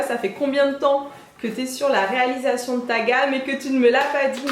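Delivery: ordinary delivery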